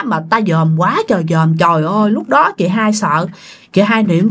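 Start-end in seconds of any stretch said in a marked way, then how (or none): none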